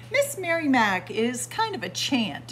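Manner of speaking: ordinary delivery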